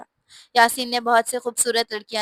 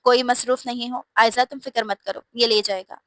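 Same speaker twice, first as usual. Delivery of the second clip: plain reading voice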